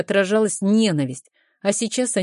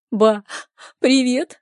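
tearful, louder and higher greeting; sobbing; 6 semitones higher, 3 dB louder, slower than usual. A narrator's reading. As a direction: sob it into the phone. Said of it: "рыдая в трубку".

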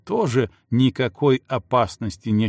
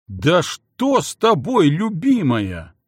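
ошеломлённо; 5 semitones higher, 4 dB louder, slower than usual. Acted. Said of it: "ошалело наблюдая".

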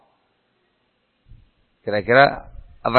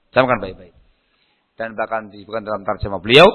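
ordinary talk, nothing marked

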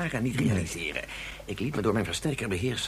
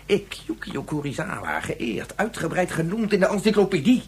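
proud outburst; 8 semitones higher, 4 dB louder than usual. Acted. Scientist boasting of his fame.

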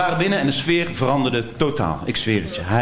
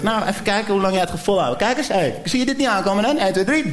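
higher taunting voice; hammed-up higher threat; taunting countdown; 8 semitones higher than usual. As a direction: taunt him before striking. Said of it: "Dus hij zegt".

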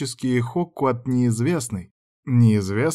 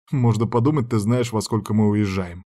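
usual speaking voice

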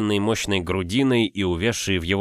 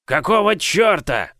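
slow, loud, high bark; 10 semitones higher, 4 dB louder than usual. Acted. Giant barks at the boy who hit him, loud and slow. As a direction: bark it out, stunned and angry. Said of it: "рявкнул он".

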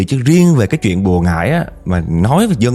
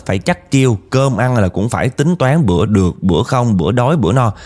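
ordinary talk, nothing marked

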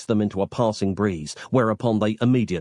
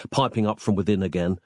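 ordinary delivery

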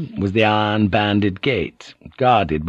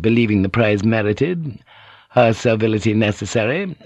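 ordinary delivery

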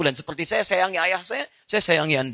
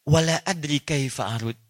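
father's helpless reply; 4 semitones lower than usual. Acted. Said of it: "kemudian ayahnya menjawab".

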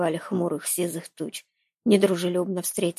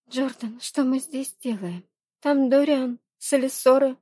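put-on higher, worried young woman; 7 semitones higher than usual. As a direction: voice Reyni, anxious and urgent. Narrator voices Reyni.

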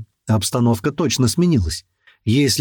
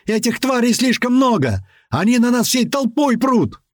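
high-pitched alarmed yell; 11 semitones higher than usual. A narrator's reading. Driver warning the crew of swarming beasts, yelling. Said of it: "заорал Рыжий".

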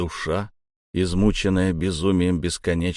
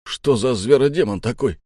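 higher-pitched baffled outburst; startled exclamation; 5 semitones higher, 4 dB louder than usual. Acted. Crewman looking at the strange corpse, startled exclamation. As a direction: exclaim it in mild disbelief.

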